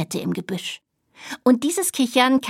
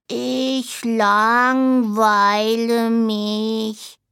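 very slow, stretched bored drawl; bored dormouse complaining to his friend; drawn out; much slower than usual. Acted. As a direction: drag every syllable out of sheer boredom. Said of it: "sagte der kleine Siebenschläfer gedehnt".

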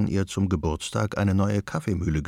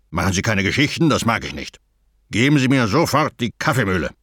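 louder, fast robber demand; gruff threatening robber; 5 dB louder, faster than usual. A narrator's reading. Robber orders grandmother to hand over the grinder, loud. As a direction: threaten her, growing louder.

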